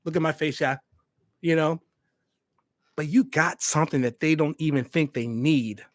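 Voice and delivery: ordinary delivery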